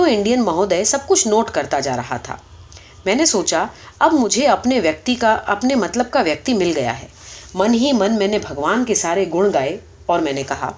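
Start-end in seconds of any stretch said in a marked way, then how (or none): none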